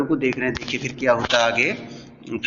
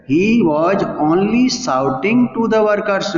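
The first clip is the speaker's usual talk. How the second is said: drawn-out, higher textbook reading; slow raised reading; 6 semitones higher, much slower than usual. Shown as a demonstration. Teacher reading the story line slowly and higher to the class. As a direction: lift the pitch and read it out word by word. Reads English text aloud slowly.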